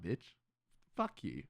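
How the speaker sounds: ordinary talk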